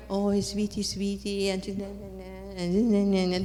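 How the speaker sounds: put-on soft, cooing, babyish sweet-talk; mock sugary Mary. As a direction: parody the saccharine Mary image. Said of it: "we think of Mary".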